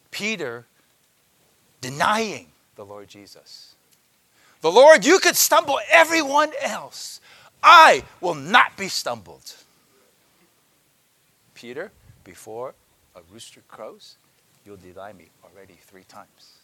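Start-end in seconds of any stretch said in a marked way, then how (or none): 4.6-9.5 high, loud vow; Peter's loud proud vow; boastful Peter. Acted. 11.5-16.5 soft, low, solemn foretelling; a hushed prophecy to Peter; the Lord's quiet warning. Acted. The Lord foretelling denial.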